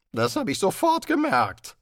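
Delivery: growled retort. Acted. Pinned wizard growling at the host, mild. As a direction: snarl back sourly. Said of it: "knurrte Rincewind".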